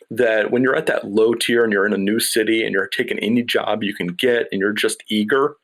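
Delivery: ordinary delivery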